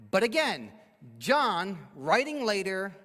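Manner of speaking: normal talking voice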